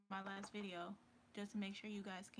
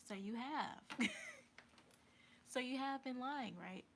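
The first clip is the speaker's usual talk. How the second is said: louder, higher, laughing as you accuse; accusing laugh; 6 semitones higher, 5 dB louder than usual. Retold or imitated. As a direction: catch him out, half laughing. Catching him in the lie.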